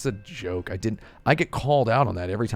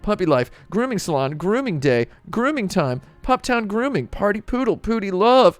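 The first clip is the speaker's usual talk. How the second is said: rising incredulous list; 9 semitones higher, 5 dB louder than usual. Real, not acted. Reads out listing hashtags.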